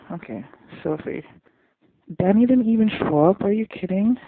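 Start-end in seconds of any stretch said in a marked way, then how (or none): 2.2-4.2 loud, high, outraged question; indignant disbelief. Real, not acted. Viewer scolding absent Danny, indignant disbelief. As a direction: call out the no-show in loud disbelief.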